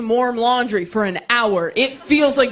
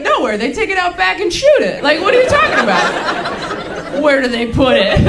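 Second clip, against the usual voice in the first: incredulous retort; 6 semitones higher, 5 dB louder than usual. Retold or imitated. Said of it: "And I'm like".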